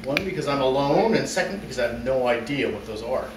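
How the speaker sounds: ordinary talk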